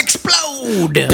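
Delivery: high punchy exclamation; excited explode. Pretend. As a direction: burst it out like an explosion. Mimicking exploding credits.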